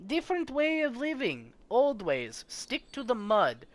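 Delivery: high, sing-song odd little man; a strange hermit talking in riddles; quirky Trigby voice. Acted. Trigby describing bog folk.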